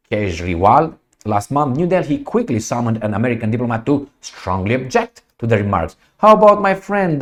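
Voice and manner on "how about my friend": higher, louder jab; sarcastic exclamation. Real, not acted. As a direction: burst in with mock cheer. Sudden sarcastic turn.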